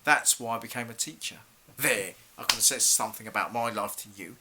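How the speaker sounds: soft, low, regretful admission; quiet, disappointed teacher opening up; subdued confiding. Acted. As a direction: drop the voice and confide lost hopes. Teacher confides lost hopes to pupil.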